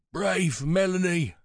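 put-on high, slow old man's voice; frail old man. A narrator's reading. Pritch comforting Melanie, slow frail old voice. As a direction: speak haltingly as a frail elder.

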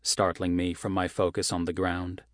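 usual speaking voice

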